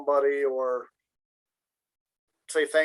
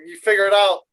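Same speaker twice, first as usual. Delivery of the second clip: louder, higher tossed-off order; mock brusque; 4 semitones higher, 7 dB louder than usual. Host giving viewers unlabeled numbers, mock brusque. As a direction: hand it over with playful impatience.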